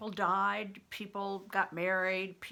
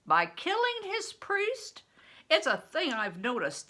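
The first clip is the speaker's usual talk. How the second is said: laughing scorn; 9 semitones higher, 6 dB louder than usual. Acted. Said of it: "with laughing scorn".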